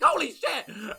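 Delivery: high, loud startled outburst; comic panicked cry; spooked yelp. Acted. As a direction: yelp as if an alarm just went off. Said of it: "have been um, thoroughly spooked".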